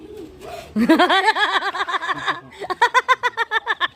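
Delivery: long real laugh; hearty laugh. Real, not acted. Laughing at a player.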